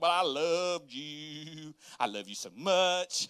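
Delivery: drawn-out, syrupy declaration; mock sweet-talk. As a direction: stretch every word to sweeten her up. Mock sweet reply to wife.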